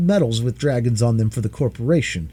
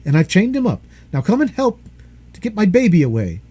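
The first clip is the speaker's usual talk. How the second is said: 1 urgent plea; 8 semitones higher, 4 dB louder than usual. A narrator's reading. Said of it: "he said".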